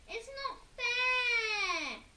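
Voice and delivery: high, loud, slow crying howl; Henry howling it's unfair. A narrator's reading. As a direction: howl through tears. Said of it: "howled Henry".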